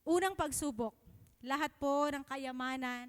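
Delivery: ordinary talk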